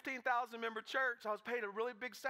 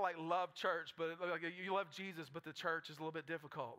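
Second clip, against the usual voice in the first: ordinary talk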